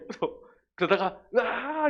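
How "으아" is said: put-on high yell of a kid hitting back; mock fighting yell. Preacher acting a kid hitting back, high mock yell. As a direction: let out a hammed-up schoolyard war cry. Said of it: "이래".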